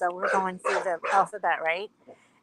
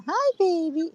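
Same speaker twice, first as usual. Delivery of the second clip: high-pitched cooing to a dog; doting baby talk; 10 semitones higher than usual. Retold or imitated. Greeting the dog at the fence, doting baby talk. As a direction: coo high at a beloved pet. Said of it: "when I see her, I go".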